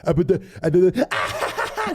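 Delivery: high, mock-deranged stammering breaking into laughter; incoherent drunken babble. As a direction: imitate someone too wasted to form a sentence. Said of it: "saying random words that made no sense together and then laughing".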